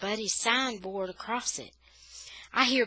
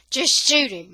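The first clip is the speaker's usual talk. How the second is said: angry despair; 6 semitones higher, 13 dB louder than usual. Acted. Mother repeating in despair.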